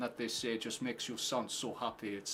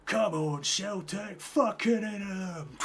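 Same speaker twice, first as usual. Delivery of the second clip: high, drawn-out yell; mock fan shout; 9 semitones higher, 5 dB louder, much slower than usual. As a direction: cry it out high, stretching the last words. Mock cheering for Celtic during match.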